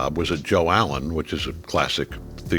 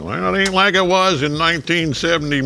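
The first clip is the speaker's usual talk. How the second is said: put-on grumpy old-timer; 10 semitones higher, 4 dB louder than usual. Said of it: "I'm like".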